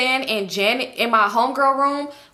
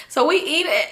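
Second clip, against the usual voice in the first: plain reading voice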